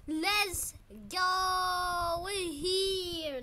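slow, loud, stretched cheer; drawn-out celebration. Real, not acted. Player finally reaching the island, drawn-out cheer. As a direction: stretch the words out in triumph.

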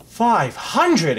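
high, astonished cry; exclaiming. A narrator's reading. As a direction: echo the figure in royal amazement. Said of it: "exclaimed the king".

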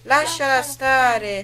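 high, slow, drawn-out plea; plead, stretching each word; imploring. A narrator's reading.

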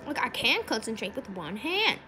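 ordinary talk, nothing marked

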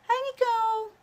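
put-on very high little-child voice saying hi; baby greeting voice. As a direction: squeak it like the baby waving hello. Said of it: "Say hi to Nicole".

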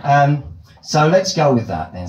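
usual speaking voice

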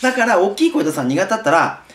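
normal talking voice

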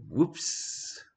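whispered whoops. Real, not acted. Reader catches own accent mistake.

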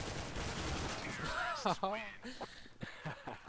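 high, hearty laugh after the shot; real laughter; burst of laughter. Real, not acted. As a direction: laugh out at the fluke.

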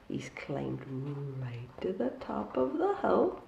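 hushed storyteller whisper. A narrator's reading. Storyteller hushing the children at the tale's peak. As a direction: breathe it to the children. Reading to children, dramatic hush.

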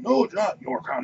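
high-pitched squabbling monster objection; contradicting Ettin head. Acted. Other Ettin head arguing back over the crown, high indignant voice. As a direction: butt in with a high, indignant denial. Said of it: "of course, they continue arguing".

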